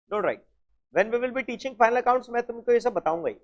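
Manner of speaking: ordinary talk, nothing marked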